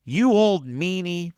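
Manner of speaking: high, slow mock whiny voice; a childish pouting "you old meanie"; quoting cartoon boy.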